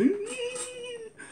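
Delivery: squeaky high laugh; high squeal of laughter. Real, not acted. Laughs at own rant.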